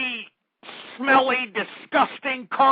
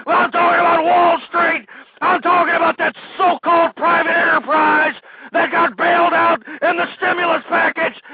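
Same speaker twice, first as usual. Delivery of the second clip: furious rant; 4 semitones higher than usual. Real, not acted. Host scolding listeners about Wall Street, shouting. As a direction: hammer the audience with rage.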